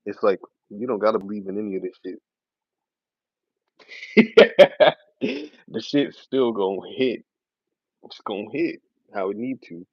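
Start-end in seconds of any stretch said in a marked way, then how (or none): 3.9-4.9 loud, high burst of laughter; amused laugh. Real, not acted. Streamer laughing at trolls who don't understand, loud laugh. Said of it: "which makes me laugh the most".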